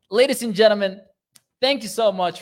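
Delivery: loud, high showman call; big announcer voice; announcer opener. Real, not acted. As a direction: hype up the sign-off. Show sign-off announcement.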